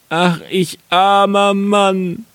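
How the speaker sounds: high, drawn-out mock wail; wailing lament. Carter finding his wine lost, wailing in high voice. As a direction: bemoan your bad luck, stretched out high. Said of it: "rief er".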